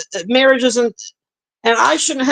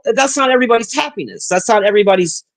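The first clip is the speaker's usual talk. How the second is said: ordinary talk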